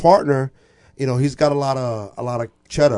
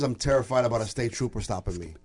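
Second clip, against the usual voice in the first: ordinary delivery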